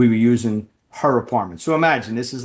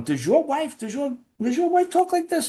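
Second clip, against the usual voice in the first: much higher, offended mobster voice; imitating the Italian mobster. Italian mob guy asking if his wife talks like this, high and scandalized. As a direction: sputter it high like a scandalized wiseguy. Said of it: "these two mob guys going".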